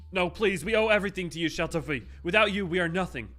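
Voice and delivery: loud desperate begging; pleading underling. Acted. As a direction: grovel and plead for mercy. Underlings beg the villain.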